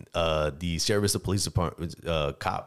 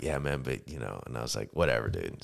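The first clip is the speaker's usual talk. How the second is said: low, much softer dismissive mumble; 8 semitones lower, 3 dB quieter than usual. Retold or imitated. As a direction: brush it off, voice sinking low. Retold dismissive exchange, voice dropping.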